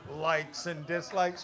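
loud, drawn-out echo of someone's words; scornful mocking repeat. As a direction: throw the phrase back slowly, in disbelief.

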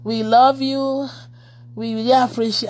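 slow, deliberate imitation of her speech; slow careful speech. Helper thanking contributors, imitated slowly. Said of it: "She talked so slowly".

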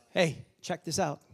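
normal talking voice

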